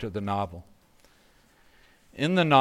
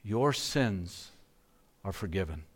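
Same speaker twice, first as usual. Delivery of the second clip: softer, gentle pronouncement; tender words of forgiveness; 3 semitones lower, 5 dB quieter than usual. Acted. Jesus speaking softly to the forgiven woman, tender. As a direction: let the pardon land softly on her. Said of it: "imagine what these words sounded like to her".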